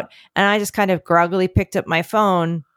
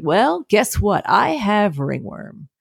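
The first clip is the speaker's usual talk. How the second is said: slow dramatic reveal; much slower than usual. Retold or imitated. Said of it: "And I was like".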